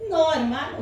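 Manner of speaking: usual speaking voice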